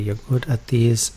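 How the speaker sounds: normal talking voice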